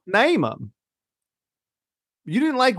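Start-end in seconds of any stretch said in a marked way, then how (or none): 0.1-0.7 higher mimicked Southern catchphrase; Southern accent. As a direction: imitate her Southern accent, pitched up. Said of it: "It's the Southern accent".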